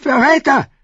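high, loud, agitated cry; agitated outburst. A narrator's reading. Young king accusing the mob of treason, agitated. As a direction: burst out in high-pitched agitation. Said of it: "stammelte Joffrey aufgeregt".

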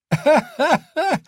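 high, mocking burst of laughter; spearwife's laugh. Spearwife mocks the fat man with a high, loud laugh. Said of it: "Eine der Speerfrauen lachte".

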